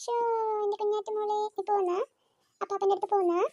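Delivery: put-on soft lullaby-like coaxing of the baby; tender baby talk. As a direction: coo gently to the baby, almost under the breath. Cooing to baby.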